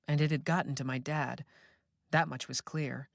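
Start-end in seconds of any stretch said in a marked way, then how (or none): none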